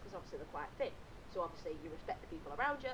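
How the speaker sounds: usual speaking voice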